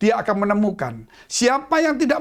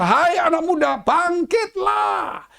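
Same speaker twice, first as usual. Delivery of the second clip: high, with bangkitlah drawn out very slow; Jesus's ringing command; commanding call; 7 semitones higher, much slower than usual. Acted. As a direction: summon the dead youth to rise.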